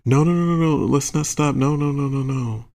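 high, insistent imitation of begging; pleading protest. Partner begging the teller not to stop, pleading. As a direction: start high and plead, easing down slightly.

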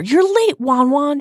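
put-on high-pitched girlish pout; sulky sing-out, starting very high. A narrator's reading. Lavender to her boyfriend Ron, pouting. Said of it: "she pouted".

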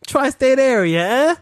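loud, high Jersey "tri-state area, eh?"; Jersey pizza guy. Pretend. Joking along as a Jersey pizza guy, loud and high. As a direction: ham up the Jersey neighborhood guy.

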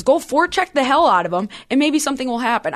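ordinary talk

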